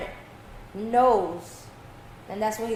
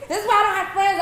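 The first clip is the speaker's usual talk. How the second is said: laughing; 7 semitones higher than usual. Real, not acted. Laughing at a joke.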